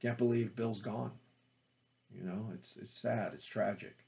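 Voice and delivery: understated sadness, kept real; quiet, sincere low-key sorrow; low energy. Shown as a demonstration. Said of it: "I bring it down".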